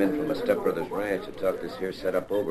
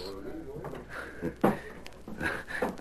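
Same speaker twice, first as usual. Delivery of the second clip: nervous laugh; 4 semitones higher, 9 dB quieter than usual. Acted. Brushing off the insult.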